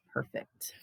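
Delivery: whispered perfect, barely voiced; breathy whisper. A narrator's reading. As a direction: let the word fade into a whisper. Whispered refrain ending.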